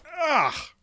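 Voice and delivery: sharp high yelp of pain. Acted. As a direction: cry out as it rips. Skin pulled off with fly paper.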